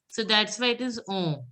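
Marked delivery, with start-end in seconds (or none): 1.1-1.4 ON said through the nose; nasal on. Shown as a demonstration. Teacher showing students the French nasal sound, nasal on. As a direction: end on a nasal vowel in on. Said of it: "nasal sound".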